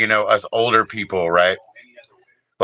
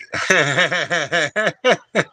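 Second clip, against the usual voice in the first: long, high staccato laugh; giggle; 6 semitones higher than usual. Real, not acted. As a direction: cackle at your own excuse. Laughs at own excuse.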